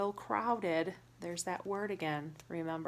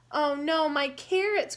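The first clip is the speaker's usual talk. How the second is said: louder, high wail; mock cry; 6 semitones higher, 9 dB louder than usual. Rabbit crying out over his lost carrots, loud and high. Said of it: "cried Rabbit".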